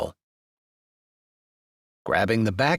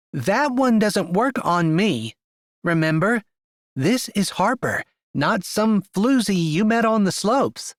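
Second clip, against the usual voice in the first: put-on higher, teasing young woman voice; sassy young woman; 8 semitones higher than usual. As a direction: voice her like a sharp-tongued friend. Harper's lines, narrator voices woman.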